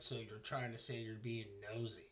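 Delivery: quiet, sneering guard. Acted. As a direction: sneer the question at them. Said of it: "He kinda sneers at you".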